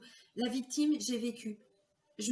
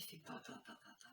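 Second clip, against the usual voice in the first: stunned murmur; 19 dB quieter than usual. Retold or imitated.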